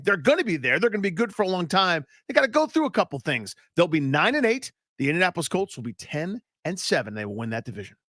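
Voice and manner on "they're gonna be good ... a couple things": ordinary talk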